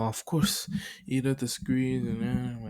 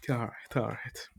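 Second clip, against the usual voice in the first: ordinary talk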